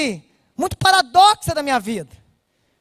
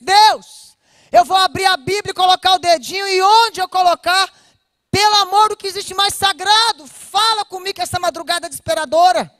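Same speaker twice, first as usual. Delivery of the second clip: loud, raised-pitch cry out to God; desperate plea; 4 semitones higher, 6 dB louder than usual. Retold or imitated. Daughter begging God to speak to her that night, desperate plea. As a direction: plead at full force, voice pushed up and loud.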